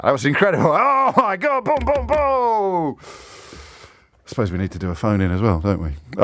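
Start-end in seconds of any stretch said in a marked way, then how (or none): 0.0-3.0 hammed-up awe, climbing far above your usual pitch; mock amazement. Host hails his own fake rewind trick, mock amazement.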